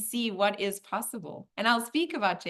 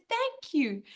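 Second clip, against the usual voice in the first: high, grateful little thank-you; acted eager, high-pitched gratitude; excited little soul; 8 semitones higher than usual. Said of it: "The little soul is so excited".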